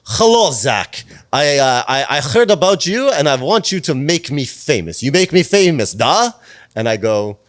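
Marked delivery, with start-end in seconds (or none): 0.1-6.3 loud, high, hammed-up Russian accent; bad Russian-Ukrainian accent. Aspiring star asks publicist to make her famous, bad Russian accent. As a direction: put on a terrible Russian accent, loud and demanding. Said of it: "this is gonna be the worst accent".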